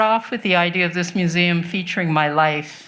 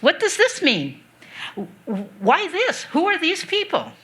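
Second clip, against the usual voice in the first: curious questions; 7 semitones higher than usual. Retold or imitated. Team's curious questions retold.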